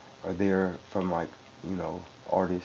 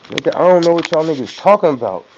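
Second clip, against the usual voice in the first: loud, high exasperated rant; baffled outburst; 6 semitones higher, 8 dB louder than usual. Retold or imitated. Rapper mocking studio tech talk, loud exasperated outburst. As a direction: throw up your hands at the jargon, loudly. Mock bafflement at engineering jargon.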